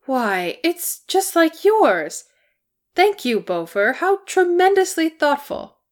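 high, loud, gushing thanks; delighted. Acted. Hobbit thanking his friend for a little flute, delighted. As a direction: gush with surprised pleasure, pitch leaping up.

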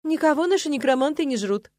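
high, loud protest from an offended horse; indignant. A narrator's reading. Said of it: "возмутилась Ириска". (возмущённо, высоко и громко)

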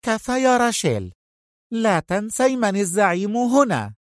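a boss putting her in place; sharp rebuke. Acted. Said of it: "قاطعتها سونيا في حدة". (مقاطعة بحدة)

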